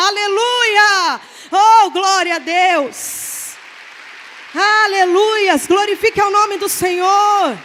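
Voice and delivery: loud drawn-out hallelujah cries; shouted praise. Acted. Pastor shouting praise to the congregation over the healed grandson. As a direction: preach it at full force, stretching the aleluia. Preacher exults at full force.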